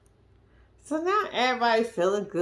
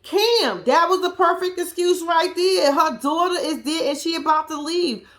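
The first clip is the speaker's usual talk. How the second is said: excited rant; 8 semitones higher, 4 dB louder than usual. Real, not acted.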